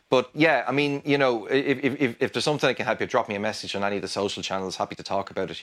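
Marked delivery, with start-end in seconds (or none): none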